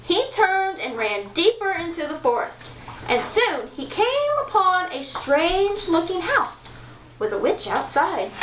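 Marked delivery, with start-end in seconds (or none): none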